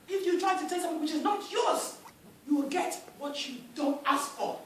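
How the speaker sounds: a king scolding his chief about the curse; stern king lecturing. Acted. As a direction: lay down the law to a greedy chief.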